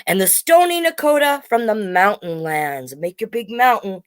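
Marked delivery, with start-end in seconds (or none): none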